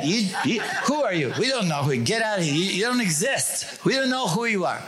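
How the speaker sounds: high, laughing, taunting mock officials; mock the regime brushing him off; mock communist officials. Acting out the regime erasing the defector, high laughing mockery. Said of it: "Oh, you're not coming?".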